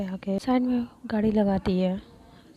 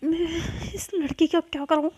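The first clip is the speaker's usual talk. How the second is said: high, loud exasperated cry; angry outburst; 7 semitones higher, 4 dB louder than usual. Acted. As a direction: fume at the impossible girl. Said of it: "बहुत गुस्से में आ जाती". (गुस्से से भरी ऊँची आवाज़)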